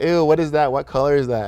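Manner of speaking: mock a schoolmate recoiling at lunch; disgusted mock teasing. Retold kids teasing his lunch.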